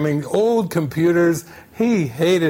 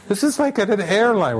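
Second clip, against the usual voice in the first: ordinary talk, nothing marked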